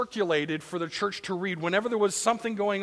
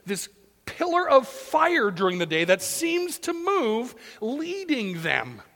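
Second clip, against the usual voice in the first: ordinary talk, nothing marked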